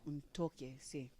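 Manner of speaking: mock the doctor in Spanish; imitating the doctor. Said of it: "my doctor said".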